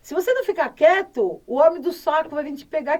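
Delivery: loud mock bogeyman threat; threatening mother. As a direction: mimic a mum's warning to her son. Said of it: "A minha mãe falava assim pro meu irmão".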